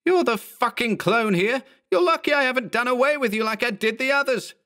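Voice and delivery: high, heated angry tirade; outraged, high-pitched threatening outburst; furious threat. Acted. Acted quarrel, character threatening his clone.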